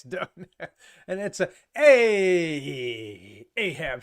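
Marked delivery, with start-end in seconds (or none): 1.8-4.0 loud, long drawn-out "Ayyy"; loud catchphrase holler, slowly falling; the Fonz. Acted. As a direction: stretch the first sound out big like a TV greaser. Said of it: "The Fonz".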